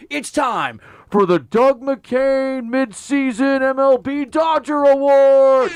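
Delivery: big slow booming segment intro; announcer voice. Acted. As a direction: announce it like a hyped radio bit.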